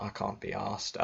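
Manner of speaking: ordinary talk, nothing marked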